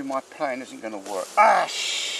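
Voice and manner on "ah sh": sudden loud, high cry cut short; alarmed yelp. Real, not acted. Beekeeper has a mishap with the frame, yelping in real alarm. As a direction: yelp and bite back the swear word.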